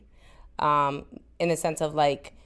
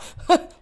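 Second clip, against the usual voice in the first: high burst of laughter; quick bright giggle; 12 semitones higher, 3 dB louder than usual. Real, not acted. Laughs at her own story.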